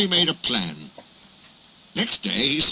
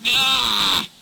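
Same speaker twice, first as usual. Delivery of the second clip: growl; 9 semitones higher, 3 dB louder than usual. Acted. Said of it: "he growled".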